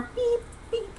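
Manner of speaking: high, soft double toot; two squeaked little honks; imitated horn beeps. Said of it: "honking the horn".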